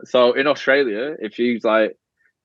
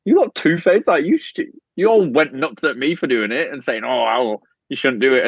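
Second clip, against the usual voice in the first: high, heated retelling of an outburst; half-playful indignant telling-off; mock angry rant; 5 semitones higher than usual. Venting to the host about two-faced housemates, mock angry.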